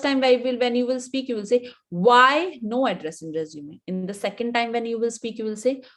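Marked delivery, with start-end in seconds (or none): 1.9-3.8 "Why" drawn out and punched louder; stress on WHY. Shown as a demonstration. Coach showing emphasis practice on the first try, stress on "Why". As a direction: lean hard on the first word "Why". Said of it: "emphasize on different, different word".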